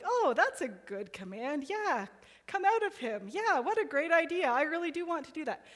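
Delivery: sarcastic, high and bright agreement; mock agreeable. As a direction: ham up eager agreement. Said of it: "It's not like he's sitting there being like".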